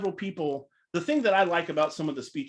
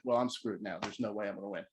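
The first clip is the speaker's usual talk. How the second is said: lower, much softer resigned mutter; deflated aside; 7 semitones lower, 9 dB quieter than usual. Retold or imitated. Speaker about to go on stage, quiet resigned aside. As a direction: mutter it under your breath, defeated. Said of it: "And I was like".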